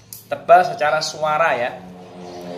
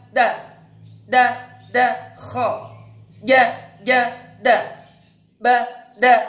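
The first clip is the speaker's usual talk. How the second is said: higher, clear, measured letter-by-letter chanting; recitation drill; 8 semitones higher, slower than usual. Acted. Teacher drilling students on kha syllables, chanted and high. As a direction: call each syllable out for students to repeat.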